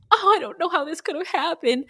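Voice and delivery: high-pitched fake distress; mock tearful. Imitating wife faking grief at police station, mock tearful. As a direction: play the bewildered widow, hammed up. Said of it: "like, crying".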